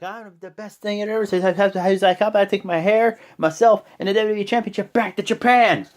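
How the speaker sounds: high, worked-up mock rant. Wrestler imitated threatening to take his title to Japan, ranting. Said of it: "He starts ranting".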